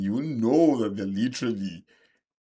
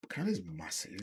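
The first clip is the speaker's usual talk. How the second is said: whispered; 3 semitones lower, 12 dB quieter than usual. Real, not acted. Stressing Canada's size.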